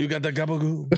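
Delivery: high, hammed-up Italian-American accent stretching gabagool; mock Italian-American accent. Echoing the cohost's joke, in a mock Italian-American accent. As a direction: echo the mob-movie accent with a laugh.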